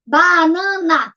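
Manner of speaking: BANANA very slowly, BA, NA, NA; syllable by syllable. Shown as a demonstration. Teacher counts the giraffe's food, syllable by syllable. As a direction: count out BANANA in three held beats. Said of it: "três".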